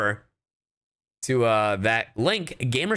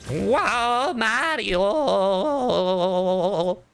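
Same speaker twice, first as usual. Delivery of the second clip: high, long-held silly wail; hammed-up cartoon holler of Mario's name; goofy Mario cry; 7 semitones higher, much slower than usual. Goofing as a Mario character.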